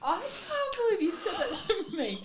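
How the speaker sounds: laughing. Real, not acted. Laughing disbelief.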